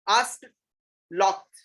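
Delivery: asked as "aast", locked as "lokt", each alone; one syllable each for asked, locked, ending in a crisp T; correct past endings. Shown as a demonstration. Teacher modelling for erring teachers, asked "aast", locked "lokt". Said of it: "It is not asked locked".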